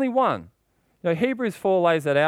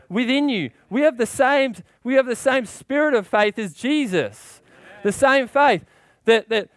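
rising preaching fervor, higher and louder; getting worked up; 6 semitones higher, 4 dB louder than usual. Real, not acted. Preacher stirring up the congregation, worked up and loud. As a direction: preach it with building fire. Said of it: "'cause I'm getting a bit worked up".

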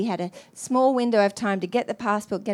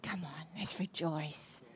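soft coaxing; 7 semitones lower, 16 dB quieter, slower than usual. Retold or imitated. Holy Spirit urging.

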